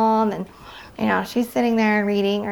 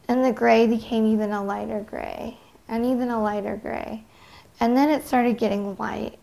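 usual speaking voice

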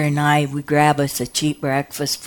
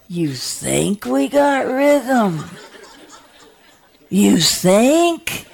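higher, slow incredulous echo; sarcastic disbelief; 6 semitones higher, much slower than usual. Retold or imitated. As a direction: throw it back, amused and unbelieving. Incredulous retort to doctor.

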